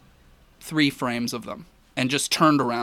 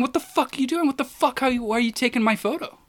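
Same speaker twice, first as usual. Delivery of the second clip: much higher, mock furious yell; imitating a huge tourist yelling at a photographer, pitched up; angry yelling; 11 semitones higher, faster than usual. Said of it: "he's screaming at me".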